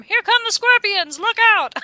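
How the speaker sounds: high, loud cartoon warning; hammed-up panic cry; mock alarm. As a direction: shout it like a silly lookout. Mock alarm about scorpions.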